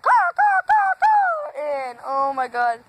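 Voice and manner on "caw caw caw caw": high squawking caw, much higher; shrill bird imitation, pitched way up. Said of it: "It was like".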